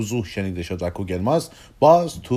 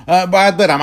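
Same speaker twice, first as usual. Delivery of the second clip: louder, higher sudden announcement; hurried goodbye; 9 semitones higher, 9 dB louder than usual. Acted. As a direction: blurt that it is time to go.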